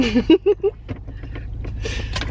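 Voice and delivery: wheezy rising giggle; giggling breathlessly through the sourness. Real, not acted. Still reacting to sour candy.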